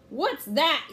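sudden pirate shout. A narrator's reading. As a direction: bark it as a startled captain. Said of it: "he shouted".

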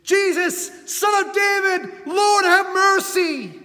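full-voiced high shout, slow at the end; desperate shout. Blind beggar crying to Jesus, desperate shout. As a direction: cry out with everything you have. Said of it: "he shouted all the more".

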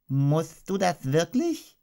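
politely hoping he'll go; a touch of strained politeness. Acted. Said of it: "sagte Kaninchen höflich".

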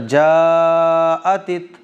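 "जाअतित" with the long vowel drawn out normally; stretched madd. Shown as a demonstration. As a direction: draw out "जाअतित" slowly. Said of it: "normal खींच के पढ़ेंगे". ("जाअतित" में मद खींचकर)

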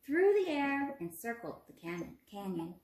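put-on storybook narration for young children; expressive read-aloud for a classroom; reading a story aloud. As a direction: read it to the class like a picture book. Teacher reads story to class.

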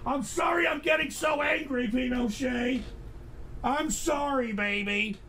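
soft, high-pitched pet talk; soothing apology. Real, not acted. Host apologising to his pet for getting angry, soothing apology. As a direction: coo the apology gently.

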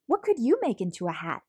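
ordinary delivery, nothing marked

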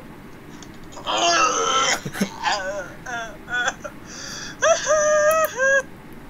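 high wailing laugh-cry; high shrieking laugh breaking into crying; laughing till crying. Real, not acted. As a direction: laugh so hard it turns into a wail. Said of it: "余韻で泣く".

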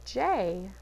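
ordinary delivery, nothing marked

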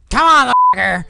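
high, loud goading; frustrated shout. Real, not acted. Player urging the game on, frustrated shout. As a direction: yell it at the game.

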